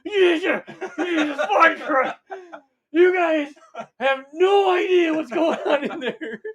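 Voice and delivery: high, loud, cackling shout through laughter; laughing Jaghatai Khan. Acted. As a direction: burst out of the goo howling with laughter. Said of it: "and he's like".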